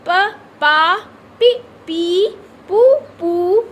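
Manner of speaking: put-on sounding out syllables for children. Shown as a demonstration. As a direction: drill each syllable loud and separately, like a teacher. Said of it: "इसको पढ़ के बताती हूँ".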